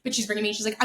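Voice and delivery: usual speaking voice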